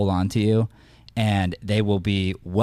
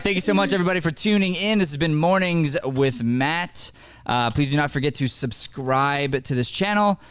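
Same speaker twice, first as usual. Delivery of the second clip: upbeat high show-host outro; bright radio host wrap-up; host sign-off; 5 semitones higher than usual. Acted. Host's sign-off.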